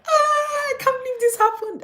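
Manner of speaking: very high, loud squeal of disbelief; shocked disbelief. Acted. Reader reacting to a twist in the book, shocked disbelief. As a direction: cry it out in hammed-up shock.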